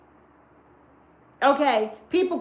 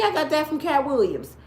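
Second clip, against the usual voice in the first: usual speaking voice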